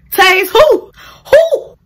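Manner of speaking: high, loud squawk; hammed-up indignant hoot; retold outraged disbelief. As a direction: shriek it as an incredulous question. Acting out outrage at taser threat.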